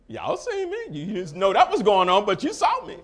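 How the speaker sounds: high and loud to the congregation; "Y'all see me", preached up high with a laugh; preaching. Real, not acted. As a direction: hit the climax, high and loud.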